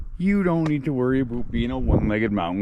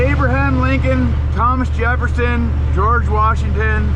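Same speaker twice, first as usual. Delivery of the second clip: excited listing; 9 semitones higher, 6 dB louder than usual. Real, not acted. Excitedly listing Rushmore presidents.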